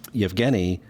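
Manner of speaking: usual speaking voice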